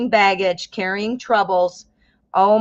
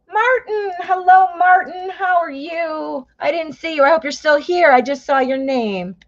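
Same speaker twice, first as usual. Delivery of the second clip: much higher, bright calling hello; excited greeting; 7 semitones higher than usual. Real, not acted. Reader spots viewer Martin in chat, excited greeting. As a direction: sing out the hello to a friend.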